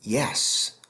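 ordinary talk, nothing marked

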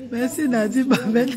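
thanks spoken through laughter; laughing thanks. Real, not acted. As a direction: giggle the thanks out. (remerciement en riant)

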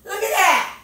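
thrilled it worked; excited. Real, not acted. Organizer seeing the shelf fit, excited.

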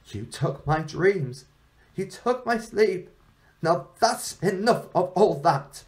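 a hint of an ancient crone, rising in pitch; old woman. Old woman wakes and speaks.

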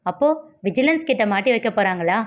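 plain reading voice

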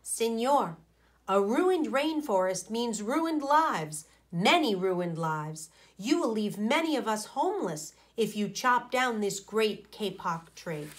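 plain reading voice

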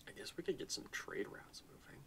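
voiceless whisper to himself; whispered aside. Real, not acted. Muttered aside while planning.

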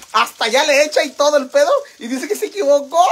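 loud, high, breaking into laughter; laughing outrage. Real, not acted. Telling viewers about his excuse, laughing outrage. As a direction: exclaim it incredulously, about to crack up.

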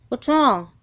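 ordinary talk, nothing marked

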